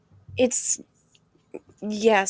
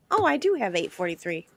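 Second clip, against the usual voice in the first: normal talking voice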